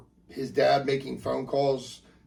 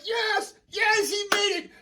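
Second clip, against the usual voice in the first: very high-pitched cheer; squealed celebration; slower than usual. Acted. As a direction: cheer like a whole overjoyed family. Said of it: "everybody's cheering like".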